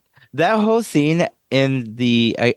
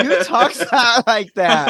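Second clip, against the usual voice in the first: laughing through a mocking question, voice pitched higher and louder; real laughter breaking into the words; 10 semitones higher, 3 dB louder than usual. Real, not acted. Recapping the movie with co-hosts, she laughs mid-question. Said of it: "I am laughing".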